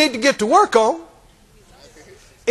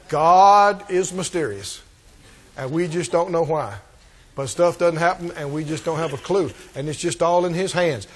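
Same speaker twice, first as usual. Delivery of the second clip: lower, soft mock-pious excuse; a hint of sanctimonious resignation; mock pious shrug; 7 semitones lower, 5 dB quieter than usual. Preacher parodying no-fault religion, softly pious. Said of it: "Oh, that sounds nice".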